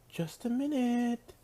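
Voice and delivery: high, drawn-out sing-song call; slow, high lilting reply. Acted. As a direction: call out musically from behind a door. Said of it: "a sing-song voice called out".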